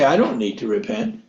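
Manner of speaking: low, dismissive rich man's brush-off; smug rich man. Retold or imitated. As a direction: wave off the need to repent. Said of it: "The rich people say".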